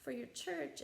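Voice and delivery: quoting the lady's gentle correction in a high voice; high mock lady voice. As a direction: lift into a sweet high imitation of the lady. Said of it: "And she's like".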